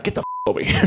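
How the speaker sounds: strained hissed threat in a squeak; angry dad. Retold or imitated. Father grabbing son by the arm, hissed mock threat. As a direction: hiss it like a cartoon dad.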